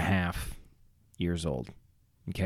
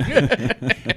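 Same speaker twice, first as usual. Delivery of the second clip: high giggle; 12 semitones higher, 4 dB louder than usual. Retold or imitated. Laugh inside the mom impression.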